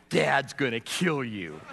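slow, low big-brother threat; gloating warning. Retold or imitated. Big brother warning Chris about Dad, slow gloating. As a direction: savor the warning, drawn out.

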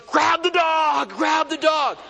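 high, loud, drawn-out shout; retold yell. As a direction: yell urgently to passers-by down the street. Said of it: "I'd be yelling".

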